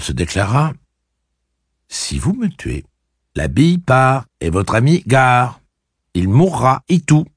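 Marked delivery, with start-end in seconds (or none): 1.9-7.3 jeune Cuthbert narquois. Acted. Cuthbert explique sa menace.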